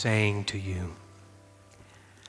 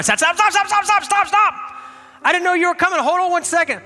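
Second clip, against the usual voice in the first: frantic shout; 6 dB louder than usual. Retold or imitated. Running out at the lawn crew.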